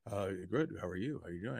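low, soft imitation of a deep voice; lightly voiced man answering the phone; a hint of a low movie star voice; Rock on the recording. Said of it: "And it's a recording".

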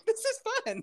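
put-on very high, giggly toddler voice; Baby Yeti. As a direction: squeal it like a delighted tiny child. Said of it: "Baby Yeti's just like".

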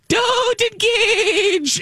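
very high, slow, floating angel voice; angelic warning. Pretend. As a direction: sing it out like Glinda from above. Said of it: "I see you floating in the air as I type".

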